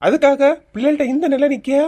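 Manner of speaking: loud, high-pitched outburst; angry shouting. Acted. Husband defending children against wife, angry shout. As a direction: shout her down in protest.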